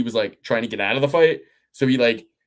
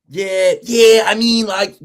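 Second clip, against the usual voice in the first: put-on a half-hearted Philly accent, pitched up; a faint hint of a Philly accent; failed Philly accent; 8 semitones higher, 6 dB louder, slower than usual. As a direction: try a Philly accent and lose it. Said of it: "I can't do the Philly accent".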